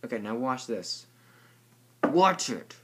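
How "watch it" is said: excited shout. Pretend. Kid acts out scene.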